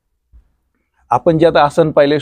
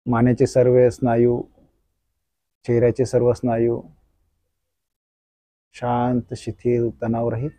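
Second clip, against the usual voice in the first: soft, slow guided meditation voice; soft spa whisper; 3 semitones lower, 6 dB quieter than usual. Acted. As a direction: lull them through a gentle body scan. Leads body-scan relaxation meditation.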